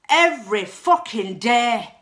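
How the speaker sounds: loud, slow, blurted-out yell; sudden shout. Retold or imitated. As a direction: let it burst out of you involuntarily. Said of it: "And I just shouted".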